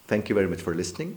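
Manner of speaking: usual speaking voice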